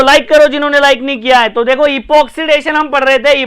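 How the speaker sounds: ordinary delivery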